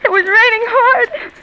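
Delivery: high, slow, tearful wail; breaking down. Acted. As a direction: let her voice crack into tears on Harry. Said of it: "Oh, Maud, sweet, don't".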